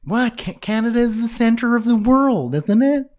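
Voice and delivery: squeaky mock disbelief; mock indignation. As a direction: play the offended Canadian, pitch up. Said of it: "Darn Canadian centrism".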